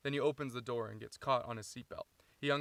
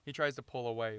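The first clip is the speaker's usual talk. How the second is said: ordinary talk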